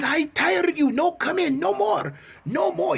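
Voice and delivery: high, accented mock outburst; imitated irate store owner; fed-up shopkeeper. Acts out the fed-up sword wielder.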